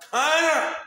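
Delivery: high, loud hail; shouted hail to his squad; excited shout. Real, not acted. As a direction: call it out to the crowd. Hailing a friend.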